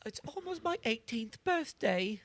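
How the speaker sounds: high-pitched, hammed-up princess voice; mock Zelda. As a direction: play her as a chirpy, self-important princess. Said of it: "the new Zelda, she's like".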